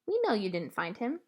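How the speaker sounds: put-on a wise old lady letting you in on it; old woman voice. A narrator's reading. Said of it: "at me and said".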